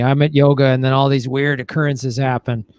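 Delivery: ordinary delivery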